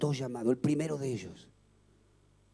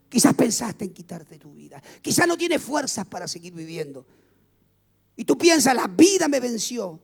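louder, higher impassioned preaching; rising preaching; 8 semitones higher, 11 dB louder than usual. Real, not acted. Preacher pleading loudly with the suicidal. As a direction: lift the voice to reach the despairing.

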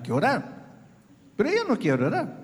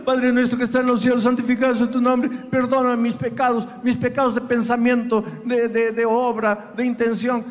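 rattled-off prayer. Pretend. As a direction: race through the prayer by heart. Imita el rezo mecánico. (rezo mecánico recitado a toda prisa)

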